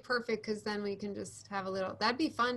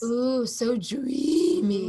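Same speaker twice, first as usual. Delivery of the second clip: long drawn-out, loud playful swoon; hammed-up dreamy admiration; mock swoon; 6 dB louder, much slower than usual. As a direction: gush over a heartthrob, stretching every vowel. Mock swooning over Mario Lopez.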